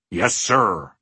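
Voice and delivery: plain reading voice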